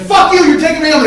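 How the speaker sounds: high, full-volume yelling; angry screamed demand. Retold or imitated. Brother demanding a ride home, screaming in anger. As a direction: scream it as a furious order.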